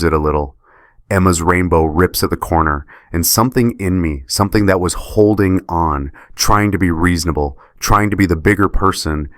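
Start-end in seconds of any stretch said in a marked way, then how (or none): none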